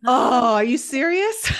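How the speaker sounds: ordinary talk, nothing marked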